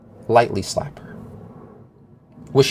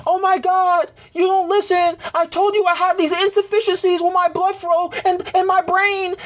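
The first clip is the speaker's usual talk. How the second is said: mocking girlfriend voice. Girlfriend quoted yelling at him.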